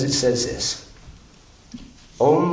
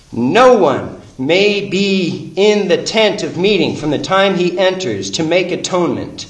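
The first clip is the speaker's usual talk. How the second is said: preached scripture; 5 semitones higher, 4 dB louder than usual. Acted. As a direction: declare the verse to the congregation. Verse re-quoted, preaching louder.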